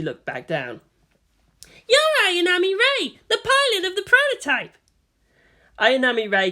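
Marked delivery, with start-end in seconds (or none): 1.9-4.8 put-on much higher, louder girl's voice; Asuka's voice. A narrator's reading. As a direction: size her up haughtily. Asuka confronts Rei.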